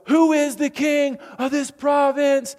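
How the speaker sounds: high, loud, slow shouted question; rallying shout to the crowd; yelled call-out. Retold or imitated. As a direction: call it out across the mountain. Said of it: "And we yell".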